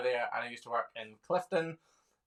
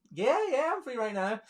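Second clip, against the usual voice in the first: eager yes; 11 semitones higher, 4 dB louder than usual. Retold or imitated. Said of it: "And I was like".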